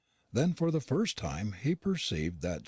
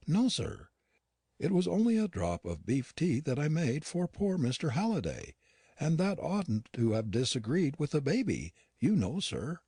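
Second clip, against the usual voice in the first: higher-voiced, worried old servant; lightly voiced housekeeper, uneasy and unwell; Yorkshire housekeeper Nancy; 7 semitones higher than usual. A narrator's reading. Nancy explains the beef tea.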